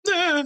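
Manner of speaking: high, loud "ah" whimper; mock whimper. Fishing buddy imitating his sleeping friend, mock whimpering. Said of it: "like, whimpering".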